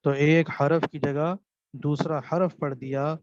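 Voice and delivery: normal talking voice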